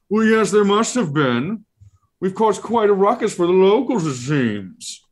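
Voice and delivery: haughty creature brushing it off; Ralakor creature voice. Acted.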